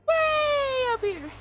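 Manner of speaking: very high, loud, drawn-out squeak; extremely high female voice. Shown as a demonstration. Mocking how high some females can go, extremely high pitch. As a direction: shoot right up into piercing falsetto, slowly. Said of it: "certain females who can go".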